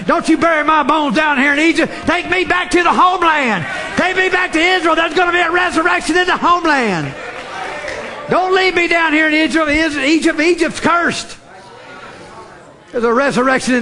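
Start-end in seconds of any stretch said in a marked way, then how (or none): 0.1-11.4 higher, shouted plea voiced in preaching fervor; Joseph's dying plea, preached. Preacher voicing Joseph's last words, shouting fervently. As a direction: cry out for the homeland like a dying man's request. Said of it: "he said".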